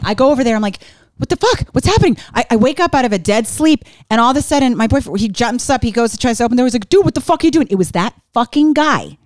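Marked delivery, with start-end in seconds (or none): 1.2-2.2 high, startled what the fuck; jolted awake and alarmed, pitched up. Retold or imitated. Woken by intruder, retold alarm. 6.9-7.7 higher, sharp mock confrontation; boyfriend confronting. Boyfriend confronting intruder at door.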